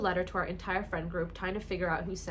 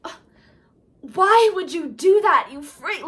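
high, slow, loud acted outrage; acted scene voice; 9 semitones higher, 12 dB louder, slower than usual. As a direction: play the bully in the scene. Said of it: "pretend I'm doing homework".

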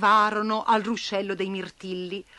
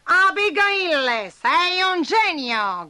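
high, slow cheering ant voice; 8 semitones higher, 5 dB louder, much slower than usual. A narrator's reading. Gambi praising Abigail's boat, high slow cheer. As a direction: cheer her like a delighted ant. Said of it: "gridò Gambi".

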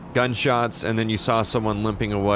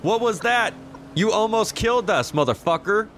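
angry yelling; 12 semitones higher than usual. Real, not acted. Near miss by speeding car.

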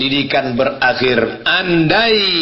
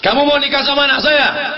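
a hint of a gruff old father, pitched up; mock stern father; 10 semitones higher, faster than usual. Preacher voicing father questioning the suitor, lightly mocking. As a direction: play the girl's father testing the suitor.

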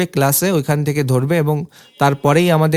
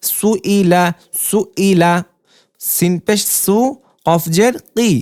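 raised, measured recitation of each syllable; spelling drill; 2 semitones higher, slower than usual. Shown as a demonstration. Teacher to students, spelling Arabic syllables in a clear drill voice. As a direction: call out every letter and vowel sign for learners to repeat.

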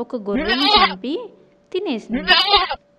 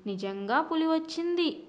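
higher-pitched, earnest report; mildly frightened; 6 semitones higher, 4 dB quieter than usual. A narrator's reading. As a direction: narrator gives a touch of a scared boy. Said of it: "భయంతో చెప్తూ". (కొంచెం భయంతో)

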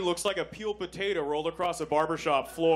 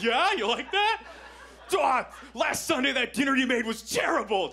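higher, louder, hammed-up insults; mock dirty talk shout; 9 semitones higher, 4 dB louder than usual. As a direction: bark it like trash talk, laughing.

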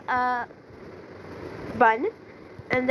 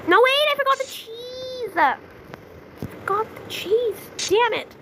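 dismayed realization; 9 semitones higher than usual. Real, not acted. Forgot cheese in cooking game.

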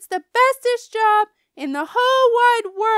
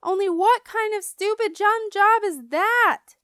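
high, loud wailing confession; frantic yell; 11 semitones higher, 11 dB louder than usual. A narrator's reading. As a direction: pour it out to mom at full volume. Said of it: "I yelled to Mother".

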